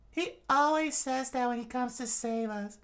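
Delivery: high falsetto, gushing; hammed-up high-pitched fan; admiring townswoman. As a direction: praise your rescuer in a high voice. Townswoman praises hero.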